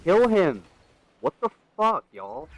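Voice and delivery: loud frustrated yell at the screen. Real, not acted. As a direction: shout it out in the heat of a fight. Shouting at game mid-fight.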